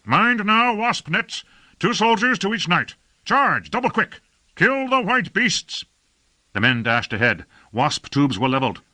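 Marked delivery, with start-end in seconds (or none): 0.1-5.8 high, urgent commander's shouts; shouted battle orders. Acted. Lord orders knights to charge the enemy, shouting.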